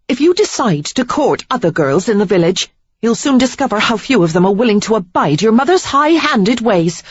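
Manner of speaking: high, loud, scornful; indignant tirade. A narrator's reading. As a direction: tear into him about his mother. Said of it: "righteous indignation that sustained her".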